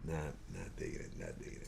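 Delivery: mock flat murmur. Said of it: "And she was just like".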